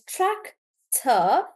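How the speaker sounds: tractor slowly split, TRAC stressed. Shown as a demonstration. Teacher explaining two syllables, tractor stressed on TRAC. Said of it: "the stress is on track".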